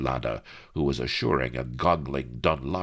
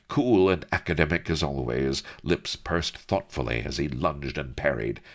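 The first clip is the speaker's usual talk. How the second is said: ordinary delivery, nothing marked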